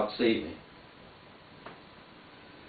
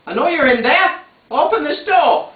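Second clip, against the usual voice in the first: shouting; 13 semitones higher, 6 dB louder than usual. Acted. Woman shouting through letterbox.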